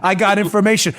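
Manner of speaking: ordinary talk, nothing marked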